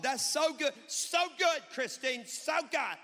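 loud, high show of enthusiasm; outward gushing. Acted. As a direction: gush with put-on admiration. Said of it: "But on the inside, I'm like".

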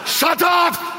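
very high comic yell; exasperated mock shout. Jesus silences babbling Peter, mock exasperated shout. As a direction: explode in hammed-up exasperation.

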